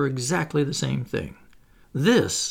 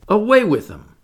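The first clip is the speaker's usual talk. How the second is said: loud, high order; commanding; 8 semitones higher, 8 dB louder than usual. A narrator's reading. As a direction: send them off like a little captain. Peter orders brothers dragged away.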